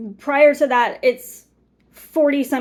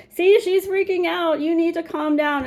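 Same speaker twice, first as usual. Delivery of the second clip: higher-pitched mimicry of her critics; mocking critics; 6 semitones higher than usual. Mimicking those who say she's freaking out, mocking. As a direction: imitate people telling you to calm down, pitched up.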